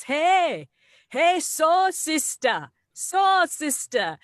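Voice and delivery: put-on high, loud, drawn-out drunken call; drunk man hollering. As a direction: call out slowly, stretching "so sister" high. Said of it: "he's drunk off his ass".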